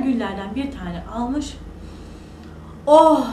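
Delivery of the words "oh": loud, higher "oh" of delight; delighted sigh. Acted. Boy smells the fragrant roses, delighted. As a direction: breathe out an admiring "oh".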